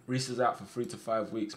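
ordinary talk, nothing marked

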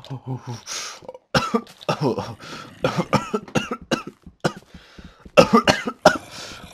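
long, hammed-up choking coughs; fake coughing fit. Player in a poisoned room, hammed-up coughing fit. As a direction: keep coughing as if poisoned, overdone. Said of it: "Okay, now they're fake coughs".

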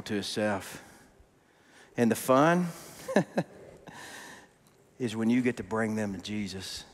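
soft, low, earnest preaching with long pauses; solemn closing appeal. Real, not acted. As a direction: bring it right down and press gently on their hearts.

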